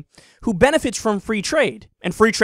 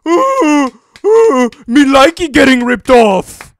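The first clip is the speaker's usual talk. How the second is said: loud, high, slow mock seal talk; dumb seal voice; 6 semitones higher, 11 dB louder, slower than usual. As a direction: bark it like a clapping seal. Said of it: "Like fucking seals".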